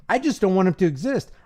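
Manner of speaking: normal talking voice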